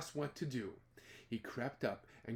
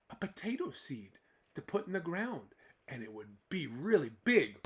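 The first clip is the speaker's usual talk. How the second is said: plain reading voice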